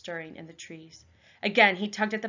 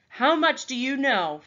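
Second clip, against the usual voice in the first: louder insisting question; repeated demand; 6 semitones higher, 6 dB louder than usual. Acted. Raven leader repeating his demand to the boy. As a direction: ask it once more, pressing.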